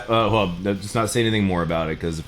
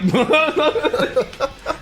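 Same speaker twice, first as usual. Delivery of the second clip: burst of laughter. Real, not acted.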